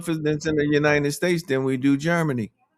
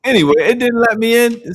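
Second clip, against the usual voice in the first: much higher and louder, fed-up yell; frustrated outburst; 8 semitones higher, 8 dB louder than usual. Real, not acted. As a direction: blow up at the trading app. Order not filled, curses after.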